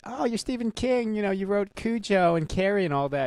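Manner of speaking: put-on high, gushing Brooklyn housewife accent, lightly done; a fan's Brooklyn housewife accent, pitched well up. Old lady fan recognizing Stephen King at the supermarket, in a light Brooklyn housewife accent. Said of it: "making her sound like a Brooklyn housewife".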